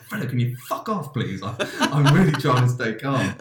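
exasperated plea. Retold or imitated. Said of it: "I was like".

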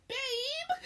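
high mocking "Babe!"; whiny girlfriend. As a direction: mimic a whiny girlfriend, laughing. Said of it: "This is what you sound like".

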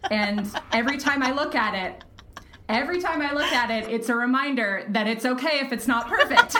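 giggling at her own perfectionism; laughing. Real, not acted. Telling the podcast hosts about her note, laughing.

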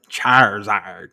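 mock Southern drawn-out name; Southern accent. Host teasing his co-host's pronunciation, mock Southern. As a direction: imitate a slow Southern pronunciation. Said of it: "why you say that like you Southern".

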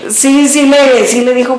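normal talking voice

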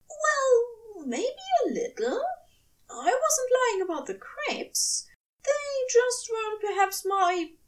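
high, hushed devoted angel voice; tender angel. A narrator's reading. Angel vowing to his lover, high and hushed.

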